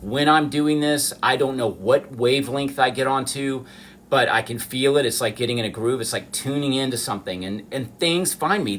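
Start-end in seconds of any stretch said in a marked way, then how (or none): none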